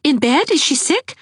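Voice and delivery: put-on worried young woman; startled young woman; alarmed Anne. A narrator's reading.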